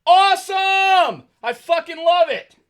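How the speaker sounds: slow, high, loud mock delight; sarcastic shout. Real, not acted. About the stinking family room, loud sarcastic cheer. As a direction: yell it like the best news ever, sarcastically.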